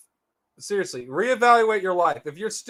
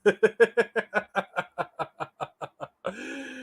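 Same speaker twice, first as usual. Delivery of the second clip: laughing; 3 dB quieter than usual. Real, not acted. Laughing after clip ends.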